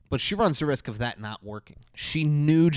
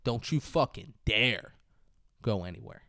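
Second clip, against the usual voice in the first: ordinary talk, nothing marked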